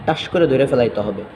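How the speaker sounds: ordinary delivery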